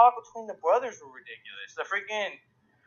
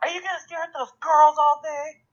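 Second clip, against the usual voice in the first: put-on much higher, louder whiny kid voice; annoying little brother; 11 semitones higher, 6 dB louder than usual. Little brother nagging his older brother, high whiny voice. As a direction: needle him like a pestering kid. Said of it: "he's all like".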